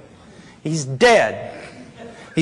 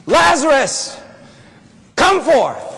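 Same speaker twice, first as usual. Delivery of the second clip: raised, ringing summons; commanding call; 2 semitones higher, 3 dB louder than usual. Acted. Preacher playing himself at Lazarus' tomb, acted commanding call.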